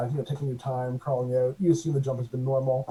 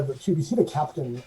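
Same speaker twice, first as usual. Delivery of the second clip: ordinary talk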